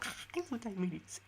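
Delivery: high, slow giggly gush; real giggling. Real, not acted. Streamer confesses love for an essay subject, giggling. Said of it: "I'm literally giggling".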